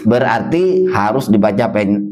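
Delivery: ordinary talk, nothing marked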